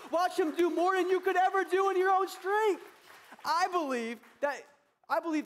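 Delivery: loud, high preaching climax; full-force preaching. Real, not acted.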